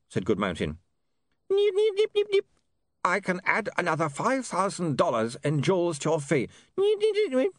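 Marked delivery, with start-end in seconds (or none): 1.5-2.5 very high repeated nips; tiny high-pitched imp chirps; fast-forward squeaks. Acted. Imp imitates fast forward noise. 3.0-6.5 lightly voiced dealmaker, pitched up; replayed character speech; recorded negotiator. 6.8-7.5 very high repeated nips; tiny high-pitched imp chirps; fast-forward squeaks. Acted. Imp imitates fast forward noise.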